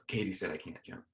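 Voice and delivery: embarrassed mutter under the breath; mumbled. Retold or imitated. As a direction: mumble it sheepishly into your chest. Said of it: "mumbled something under his breath".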